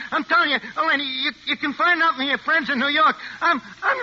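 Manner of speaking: high, pleading and frantic; panicked pleading. Acted. Crook at gunpoint begs the agent to spare him, panicked. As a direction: beg her to believe you.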